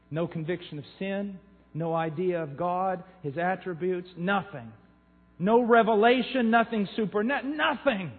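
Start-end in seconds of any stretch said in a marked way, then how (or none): 5.4-8.1 louder, higher punch on each no; emphatic preaching. Real, not acted. Preacher decrying shallow conversions, emphatic rising preaching. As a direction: drive the list home.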